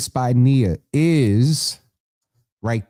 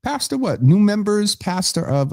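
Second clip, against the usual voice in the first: teasing; 12 semitones higher than usual. Real, not acted. Teasing host calls out Sharon.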